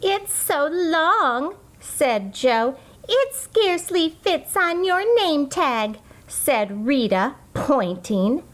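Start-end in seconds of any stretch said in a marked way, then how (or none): 0.0-1.5 high, slow mocking drawl; teasing. Classmate teasing Chrysanthemum about her long name, slow and high. 3.1-6.0 high mocking child voice; teasing classmate. Rita teasing Chrysanthemum about her name tag, high and mocking.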